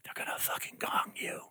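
soft unvoiced whisper; hushed angel whisper. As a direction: breathe it like a secret warning. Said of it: "a little angel whispered to me".

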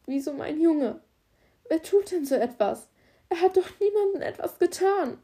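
a mother crying out for her dead son; tearful distress. Acted. Mother to the police officer, crying out in grief. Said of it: "beginnt zu weinen".

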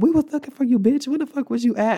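high, loud outburst imitating a furious friend; angry friend confronting. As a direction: yell it like an acted-out confrontation. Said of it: "just started swinging and was like".

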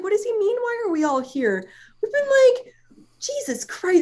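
high, squeaky-pitched baffled protest; mock dismay. Retells baffled dismay at the question.

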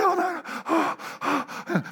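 high, breathless gasped oh's; hammed-up panting, pitched high; mock panting. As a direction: imitate an excited interpreter panting. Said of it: "panting like".

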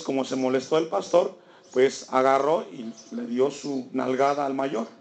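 ordinary delivery

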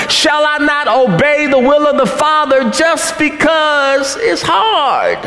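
high, ringing preacher voice building to a shout; fervent sermon climax; preaching at full force. Acted. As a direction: preach it, rising toward the cry on hard. Preaches Jesus' resolve, building.

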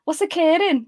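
high, loud Welsh lilt; Welsh accent. Shown as a demonstration. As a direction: put on a bouncy Welsh accent, pitched well up. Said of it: "get the, the accent in there".

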